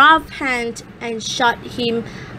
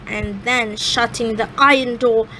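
ordinary delivery

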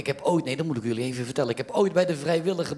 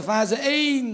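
calling out; 11 semitones higher, 4 dB louder, much slower than usual. Retold or imitated. Husband calling his wife from bed, loud call. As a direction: call it high down the stairs.

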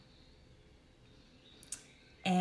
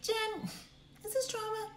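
follower asking; 14 semitones higher, 8 dB quieter than usual. Retold or imitated. Voices a follower asking her offline.

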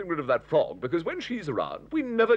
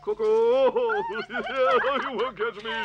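teasing call; 4 semitones higher, slower than usual. Acted. Hector taunts whoever is it, teasing call. Calling out teasingly in tag game.

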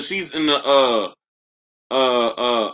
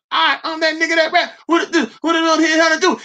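much higher falsetto mock rapper voice; 14 semitones higher than usual. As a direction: squeak it out like a joke rapper. Mock rapper bit at end.

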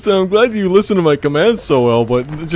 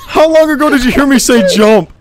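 exasperated yell; 7 semitones higher, 5 dB louder than usual. Real, not acted. Berating teammate.

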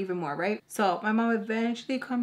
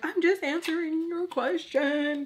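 high-pitched imitated whine; tearful protest; 9 semitones higher, 3 dB louder than usual. Daughter answering screaming mom, tearful protest. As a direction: protest in a hammed-up wail.

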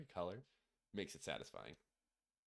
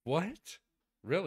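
surprised; 10 semitones higher, 10 dB louder, slower than usual. Real, not acted. Shocked at mystery flavor news.

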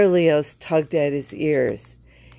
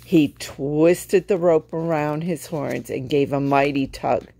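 ordinary delivery

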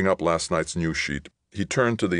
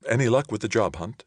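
higher-pitched, lightly voiced mother; lightly voiced woman's inquiry, pitched up; mother asking; 8 semitones higher than usual. A narrator's reading. Mother to son coming into the kitchen, asking with interest.

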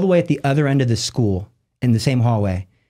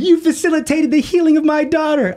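very high imitated wail of words; overwhelmed mother. Mother in tears to the teacher, overwhelmed high cry. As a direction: imitate a tearful mom insisting, pitched way up. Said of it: "She comes out of the classroom in tears".

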